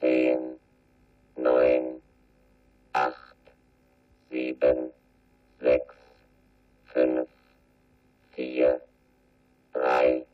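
very low machine-like countdown; deep slow rocket countdown voice; robot countdown. Acted. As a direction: count down like an onboard computer.

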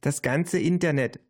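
put-on high-pitched child voice; Tiffany. A narrator's reading. Narrator voices little Tiffany. (hohe Kinderstimme)

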